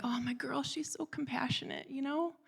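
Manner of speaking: soft, high, airy coo; tender gushing. Retold or imitated. Mother admiring her daughter's kindness, tender gushing. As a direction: melt with tenderness in a high hushed voice.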